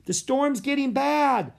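high, slow shout over the storm. A narrator's reading. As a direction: call out loudly in worry. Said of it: "shouted Annie".

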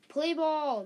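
loud, high call to start the game; umpire yell. Acted. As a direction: shout it across the field like an ump. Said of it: "the ump yelled".

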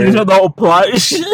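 loud, high, cracking up; shouted teasing. Real, not acted. Co-host teasing the host's searches, shouting in hilarity. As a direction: shout it through laughter.

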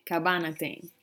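usual speaking voice

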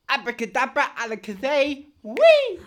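magician voice. Acted. Magician character casting spell.

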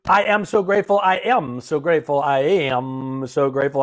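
repeated gratitude affirmation, said with conviction; emphatic affirmation. Shown as a demonstration. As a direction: proclaim it like a mantra, loud and fervent. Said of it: "I want you to say it three times".

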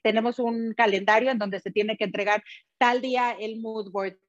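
ordinary delivery

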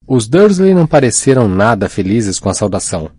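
plain reading voice

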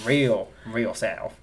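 very slow deep-South drawl; slow, hammed-up southern twang; deep southern accent. Shown as a demonstration. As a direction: stretch every vowel way down south. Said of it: "I can go s-, real south".

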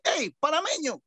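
high-pitched hail; imitated call-out. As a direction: call out as if spotting a countryman. Calling out to someone at airport.

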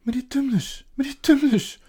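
high, loud cries of the name; startled, pitch leaping up; alarmed. A narrator's reading. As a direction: call out in fright. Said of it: "zei Lucie geschrokken".

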